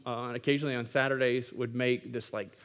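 ordinary delivery

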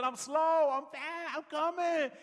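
high, loud mock holler; acted shout across a distance; retold calling out. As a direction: yell back as a lagging dad. Dad yells back to daughter.

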